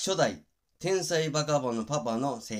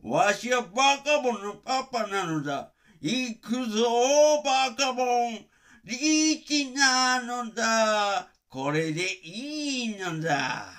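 much higher, louder papa voice, drawn out slowly; 富田康生's Bakabon Papa; 11 semitones higher, 5 dB louder, slower than usual. Retold or imitated. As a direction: stretch every word like a giddy cartoon dad. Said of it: "富田康生さんの声真似". (二代目バカボンのパパのゆっくり高い声真似)

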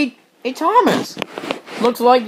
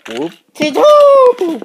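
much higher, loud, slow drawn-out whoop; slow cheer; 9 semitones higher, 10 dB louder, much slower than usual. Acted. Engine cheering while jumping up and down. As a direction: sing it out slowly.

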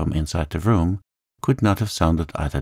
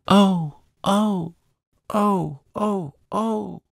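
high, repeated wailing ohs; child's howl; 14 semitones higher, much slower than usual. A narrator's reading. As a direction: howl tempestuously like a little girl. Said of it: "Lottie howled tempestuously".

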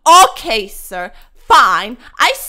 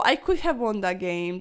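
normal talking voice

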